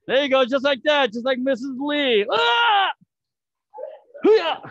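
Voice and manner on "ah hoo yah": sharp high shout of spirit; karate yell. Shown as a demonstration. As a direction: belt out a martial arts kiai.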